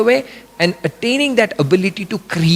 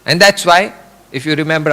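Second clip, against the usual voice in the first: ordinary delivery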